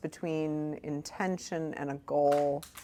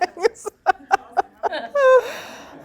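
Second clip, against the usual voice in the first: loud, high burst of real laughter; helpless high-pitched laughing fit; big laugh; 9 dB louder than usual. Real, not acted. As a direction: crack up hard at your own story. Cracks up at own story.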